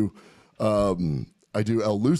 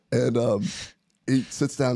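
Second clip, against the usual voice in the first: ordinary talk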